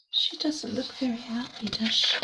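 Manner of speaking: whispered aside about the picture; soft unvoiced aside. Real, not acted.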